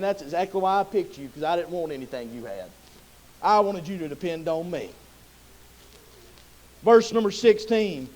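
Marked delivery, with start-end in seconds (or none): 0.0-4.9 much softer and lower, tender; gentle Lord. Acted. The Lord quietly answering Gideon's doubts. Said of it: "It's like the Lord saying".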